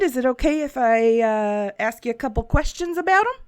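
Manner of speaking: put-on a light Southern shopkeeper accent. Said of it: "It just continues to go Southern".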